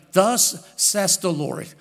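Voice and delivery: very slow, drawn out in English, a different voice; slow English quote. Shown as a demonstration. Preacher quotes the verse slowly to the congregation, drawn out. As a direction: read the verse slowly and deliberately. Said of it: "첫 번째 뭐라 그러냐?".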